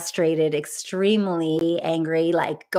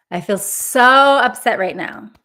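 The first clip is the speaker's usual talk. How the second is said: loud, higher-pitched venting; voiced upset; 4 semitones higher, 8 dB louder than usual. Shown as a demonstration. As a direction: push the upset out on SO. Modelling venting for participants.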